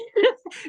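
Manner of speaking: sudden high burst of laughter. Real, not acted. Laughing while telling the concert story. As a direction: laugh out loud at the memory. Laughs retelling sister's bawling.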